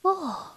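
soft, weary sigh; long sigh. A narrator's reading. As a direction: let out a quiet sighing "oh". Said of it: "妈妈长叹了一声". (轻声长叹)

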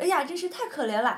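a horse mourns the prince; hammed-up pity. Second horse pities prince.

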